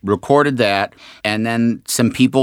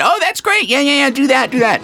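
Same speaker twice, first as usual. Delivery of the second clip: high-pitched, excited producer reaction; eager approval; faster than usual. Retold or imitated. Producers approving the audition voice, excited.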